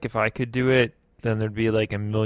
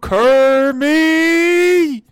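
high, loud, drawn-out wail of defeat; mock cursing shout; 13 semitones higher, 9 dB louder, much slower than usual. Defeated owner cursing the dog, hammed-up high wail. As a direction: curse your foe theatrically, long held vowels.

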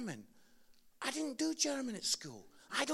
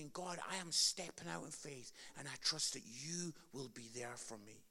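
quiet prayer; 4 semitones lower, 6 dB quieter than usual. Shown as a demonstration. Modelled prayer for congregation.

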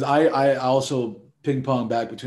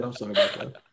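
usual speaking voice

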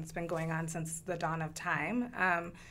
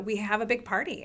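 usual speaking voice

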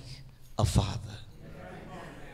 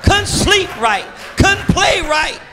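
raised preacher's call; preaching; 9 semitones higher, 5 dB louder than usual. Acted. Preacher calling out to the congregation, raised.

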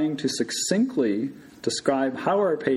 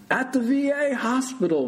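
high-pitched shouted answer; 12 semitones higher than usual. A narrator's reading. Patient answers orientation question, shouting. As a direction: yell the answer back from under covers. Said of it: "Mister Stoltz shouted".

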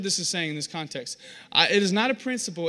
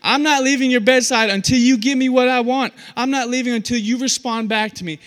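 loud, higher-pitched stubborn demand; insistent persisting; 5 semitones higher, 10 dB louder than usual. Acted. Believer demanding God's response, loudly insistent. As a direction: plead forcefully and refuse to budge. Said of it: "He's just persisting".

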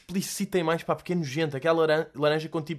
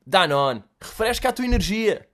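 ad voiceover; 5 semitones higher, 5 dB louder than usual. Retold or imitated. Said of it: "a fazer a voz para este anúncio".

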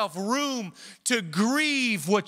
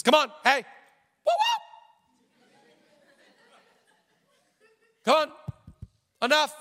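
higher and louder, brushing off grief; hammed-up impatient "enough"; mock impatient dismissal; 7 semitones higher, 4 dB louder, faster than usual. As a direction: scoff at someone still grieving. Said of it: "he never looks at your grieving and says".

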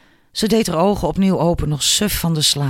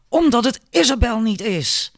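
thundering, furious; 8 semitones higher than usual. A narrator's reading. Father refusing to remarry, thundering with fury. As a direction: fume it out as an enraged father. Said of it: "donderend en woest".

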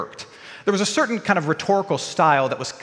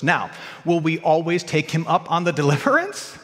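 normal talking voice

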